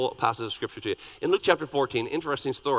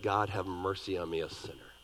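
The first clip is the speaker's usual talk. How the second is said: low and softer, sinking to a hush; humble tax collector; 9 semitones lower, 10 dB quieter than usual. Acted. Tax collector begs God for mercy, low and hushed. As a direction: plead for mercy, head bowed.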